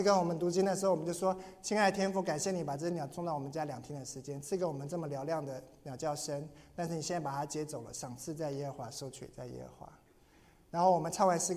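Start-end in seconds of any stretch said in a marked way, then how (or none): 1.6-9.9 soft prayer. Retold or imitated. Retold prayer at bird funeral.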